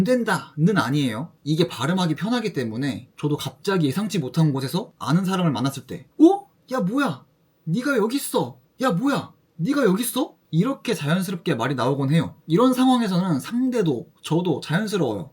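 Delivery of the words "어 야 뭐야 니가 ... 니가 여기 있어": pleasantly surprised. Shown as a demonstration. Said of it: "반가운 마음에".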